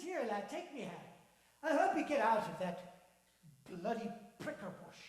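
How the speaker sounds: cheery Irish-tinged man; lightly Irish old man. Acted. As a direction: marvel at the lad's luck. Voices Donald.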